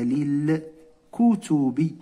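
للكتب drawn out syllable by syllable, li-l-ku-tu-bi; slow teacher demo of للكتب; very slow model reading. Shown as a demonstration. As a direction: spell out للكتب very slowly for pupils. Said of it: "هكذا،".

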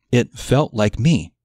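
normal talking voice

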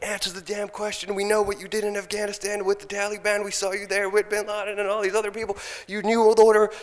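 high, loud accusing rant building to a yell; retold confrontation. Demanding Brzezinski answer the question, yelling accusations. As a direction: hurl the accusations at him, rising.

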